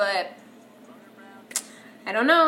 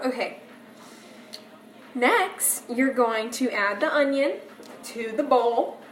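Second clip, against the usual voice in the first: ordinary delivery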